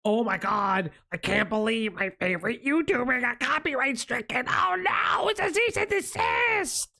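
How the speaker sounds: high, whiny fanboy panic rising to a shout; mocking fan voice. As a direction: squeal it like a fan whose favourite YouTuber got struck. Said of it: "this kid's one of those fuckers that".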